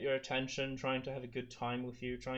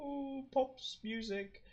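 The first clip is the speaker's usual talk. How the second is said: silly high voice; 13 semitones higher, much slower than usual. Pretend. Mock singer voice.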